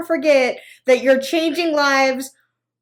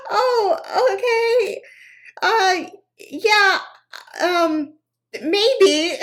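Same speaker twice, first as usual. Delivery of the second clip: high, slow, awkward laugh; awkward laughing reply; 8 semitones higher, much slower than usual. Retold or imitated. Woman dodging a saleswoman's invite, laughing. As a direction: stall politely through nervous laughter. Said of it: "I'm like".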